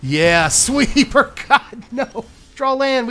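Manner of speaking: high, loud laughing groan at a bad draw; laughing outcry. Real, not acted. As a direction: laugh out in disbelief.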